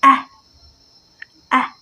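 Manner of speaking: "ah" said as a short "a" as in cat; short front American vowel for "ah"; American A sound. Shown as a demonstration. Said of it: "But Americans say".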